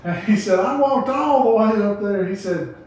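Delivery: high, loud back and forth of sinner and preacher; call and response. Retold or imitated. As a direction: play both the sinner and the preacher, hammed up. Acted preacher and sinner exchange.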